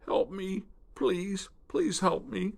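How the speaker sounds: high, pleading croak on the edge of tears; sobbing croak. Acted. Wounded gator sobs to the spoonbill, pleading. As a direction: beg through sobs. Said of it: "Sobbing, Stumpy croaked again".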